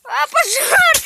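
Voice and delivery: high crying scream of fire; panicked scream. Real, not acted. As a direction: shriek in real alarm. Fire spreading, kid screams.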